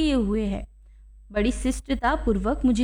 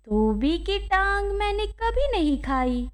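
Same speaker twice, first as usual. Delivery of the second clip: slow, higher, drawn-out regret; plaintive old man's voice, pitched up; sorrowful; 7 semitones higher than usual. A narrator's reading. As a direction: lament it as the old clerk. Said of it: "दुख प्रकट करते हुए कहा".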